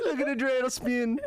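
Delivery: put-on Jamaican accent. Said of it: "in Jamaican Inception".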